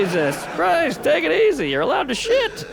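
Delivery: high-pitched comic protest; exasperated reassurance. Acted. Man answers the screaming woman, mock exasperation.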